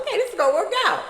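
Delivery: high, fast optimistic chirp; hopeful thought. Retold or imitated. Meeting the court officer, hopefully thinking it'll go well. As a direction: rush it out, full of hope.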